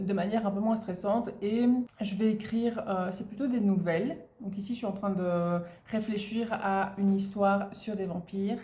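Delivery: usual speaking voice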